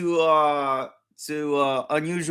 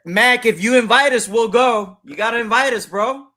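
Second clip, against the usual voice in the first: loud, high call to a friend; 7 semitones higher, 6 dB louder than usual. Real, not acted. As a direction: shout it out to Mac. Calling out to Mac on stream.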